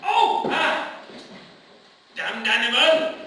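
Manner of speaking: loud yelp then a curse; startled cry. Acted. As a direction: jump and swear at the rat. Startled by the rat.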